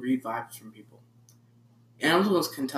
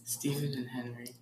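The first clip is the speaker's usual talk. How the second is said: whispering; 2 semitones lower, 11 dB quieter than usual. Pretend. Teasing couple name.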